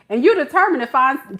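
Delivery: normal talking voice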